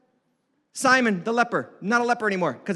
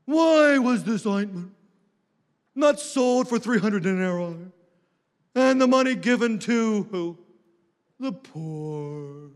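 sanctimonious, slow, drawn-out religious tone; mock a pious hypocrite, stretching every phrase; Judas; slower than usual. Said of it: "Oh, it sounds so religious, doesn't it? It sounds so sanctimonious".

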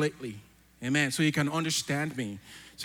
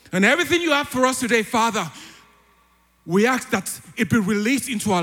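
louder, higher fiery prayer that casts out spirits; preaching at full force; 5 semitones higher, 4 dB louder than usual. Real, not acted. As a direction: declare it with rising fervour over the music. Prays loudly against spirits.

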